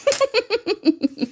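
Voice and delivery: long falling giggle; high laugh. Real, not acted. Host laughing at Rebecca's comment, high giggle. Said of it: "Rebecca qui me fait rire aussi".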